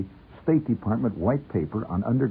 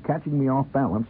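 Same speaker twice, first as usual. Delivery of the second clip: ordinary delivery, nothing marked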